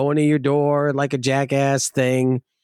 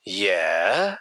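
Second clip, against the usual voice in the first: a low, flat, softer yeah; low flat mock Chewy; 7 semitones lower, 4 dB quieter than usual. Debt collector Chewy answering flatly, low deadpan voice.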